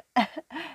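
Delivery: soft little giggle; a quick fairy giggle. Acted.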